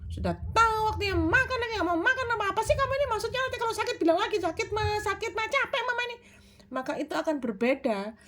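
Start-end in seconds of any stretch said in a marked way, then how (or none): 0.1-6.2 fast, high-pitched scolding without pauses; rapid nagging. Shown as a demonstration. Mother nagging a child at mealtime, fast and high. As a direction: rattle it off high, no gaps. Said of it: "menggunakan bahasa cepat misalnya".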